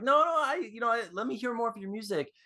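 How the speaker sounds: high-pitched mock deflection; brushing him off. Fan brushes guitarist's hand away, deflecting in a high voice. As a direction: lightly fend someone off in a girlish pitch. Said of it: "brushes it away".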